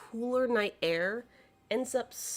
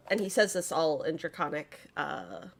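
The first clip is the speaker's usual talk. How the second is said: normal talking voice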